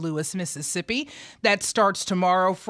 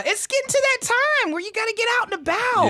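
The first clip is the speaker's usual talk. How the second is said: excited; 14 semitones higher, much faster than usual. Real, not acted. Lively excited host.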